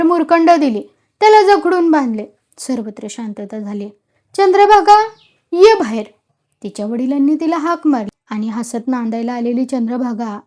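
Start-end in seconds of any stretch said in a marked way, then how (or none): 4.4-6.0 loud, high call to the daughter inside. A narrator's reading. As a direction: call her out of the house. Father calls daughter out. (वडील मुलीला बाहेर हाक मारतात, मोठ्याने हाक)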